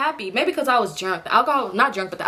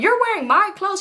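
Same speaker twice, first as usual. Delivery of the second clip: high mock scream; 8 semitones higher than usual. Friend screaming at the homegirl over her clothes. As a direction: keep shrieking the accusation. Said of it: "she just kept screaming".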